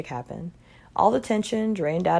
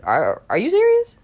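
high, loud stammering disbelief; can't believe he means it, voice jumping up; incredulous; 11 semitones higher, 6 dB louder than usual. A narrator's reading. Margot to her date about the movie, incredulous and loud.

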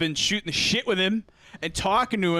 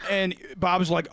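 ordinary talk, nothing marked

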